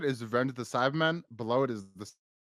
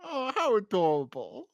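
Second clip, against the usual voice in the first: high, slow coo; 9 semitones higher than usual. Real, not acted. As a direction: coo over something cute. Cooing at tiny Master.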